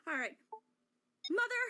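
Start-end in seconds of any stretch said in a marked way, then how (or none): 1.3-1.6 pleading cry. Acted. Persephone pleading.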